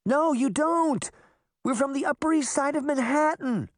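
high, panicked protest; high-pitched frantic retort; crying out. A narrator's reading. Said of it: "Sabrina cried".

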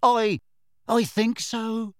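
high, halting, slightly dazed answer; mildly acted shakiness; shaky. A narrator's reading. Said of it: "she said shakily".

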